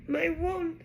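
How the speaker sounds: high, distressed wavering; shaky voice. A narrator's reading. Ron finds his wand broken, shaky. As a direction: say it shakily, high-pitched. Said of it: "in a shaky voice".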